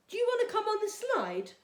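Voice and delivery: put-on high, bright little-girl question; a girl at the park inviting a boy onto the slide; girl's voice. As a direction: ask it like an eager young girl. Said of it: "a voice asked".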